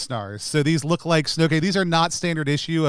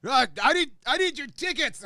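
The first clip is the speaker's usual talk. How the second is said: flustered ticket conductor; 11 semitones higher than usual. Acted. Conductor with goo smeared on his face, flustered high voice. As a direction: protest in a high put-on voice.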